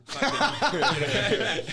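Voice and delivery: loud, high laughter breaking through the words; laughing hard. Real, not acted. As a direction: crack up mid sentence. Laughing at universe quote.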